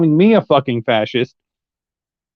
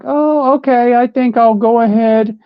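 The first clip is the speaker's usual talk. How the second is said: high, airy mock of a rival selling out; mocking sellout voice; 5 semitones higher than usual. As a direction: sneer it up high like a smug sellout. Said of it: "you're like".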